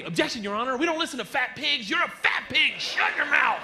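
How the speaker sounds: rising to a high, loud shout; the voice in his head playing a courtroom lawyer; mock lawyer objection. Inner voice eggs him on, high mock courtroom shout. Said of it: "Obje-, say".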